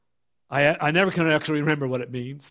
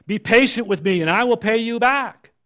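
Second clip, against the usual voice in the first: pleading, higher and louder; begging; 8 semitones higher, 6 dB louder than usual. A narrator's reading. Fellow servant begging the servant for time, pleading. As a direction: plead for mercy on your knees. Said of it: "fell to his knees and begged him".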